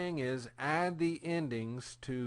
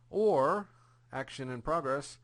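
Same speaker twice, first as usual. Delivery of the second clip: ordinary delivery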